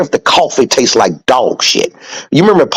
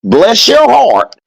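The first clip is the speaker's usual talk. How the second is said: high, slow, sugary bless your heart; mock sweet southern phrase; 10 semitones higher, slower than usual. Shown as a demonstration. Explaining a southern insult to podcast listeners, mock sweet. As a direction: sing it out sweetly, dripping with sarcasm. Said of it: "If you don't know".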